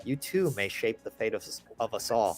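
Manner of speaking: soft, ominous fortune line; ominous hush. Acted. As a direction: drop low like a prophecy. Ominous closing of card.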